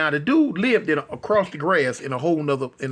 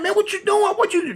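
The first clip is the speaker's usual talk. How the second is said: startled protest; 13 semitones higher than usual. Retold or imitated.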